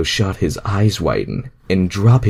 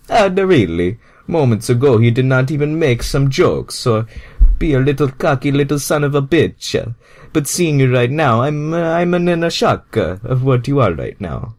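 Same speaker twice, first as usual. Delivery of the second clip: shocked Mario; 4 semitones higher than usual. Acted. Mario reacting in story.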